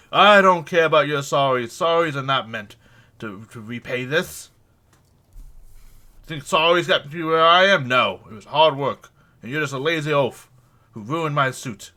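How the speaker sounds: loud, self-important tirade, dropping to a cold close; pompous furious gentleman. Acted. Sir Henley Ricker berating the worker, loud and pompous. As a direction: lecture the lazy oaf, then announce your name with pride.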